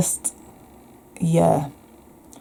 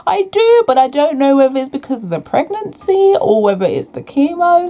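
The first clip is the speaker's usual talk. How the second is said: much higher and louder, a mock-exasperated reply; exasperated retort; 9 semitones higher, 4 dB louder than usual. Answering the doctors' question, exasperated and much higher. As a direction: fire back, half laughing, half fed up. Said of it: "I'm like".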